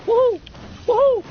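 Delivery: high, loud victory whoops; excited whoop. Real, not acted. Streamer whooping at a clean game kill. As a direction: cheer like you just won.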